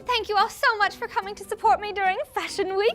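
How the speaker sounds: imitating a posh pony thanking her friends, high and rising to loud; Rarity voice. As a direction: play the grand, gracious fashion pony. Narrator voicing Rarity's thanks.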